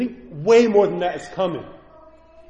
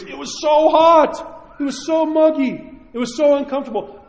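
exclaiming heat; 9 semitones higher, 4 dB louder than usual. Retold or imitated. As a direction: stretch out how hot it was. Recalling unbearable Greek heat.

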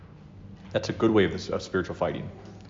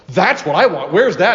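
eager retold excitement; 7 semitones higher, 9 dB louder than usual. Retelling own eager reaction.

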